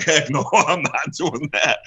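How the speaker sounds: high, drawn-out mock refusal with a laugh; retold refusal. Wrestler retelling his answer to the indie guy's dare, amused refusal. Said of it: "And I'm like".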